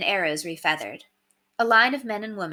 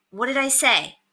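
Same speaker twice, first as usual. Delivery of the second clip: stern; 6 semitones higher than usual. Acted. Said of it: "said Curtis sternly".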